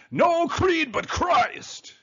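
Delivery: high, loud, drawn-out Scots-Irish cry; slow mock-grand Scots-Irish slogan; Scot-Irish accent. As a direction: proclaim it as a hammed-up Scots-Irish immigrant. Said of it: "in his Scot-Irish, uh, immigrant accent".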